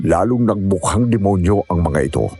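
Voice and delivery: usual speaking voice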